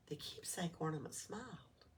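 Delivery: ordinary delivery, nothing marked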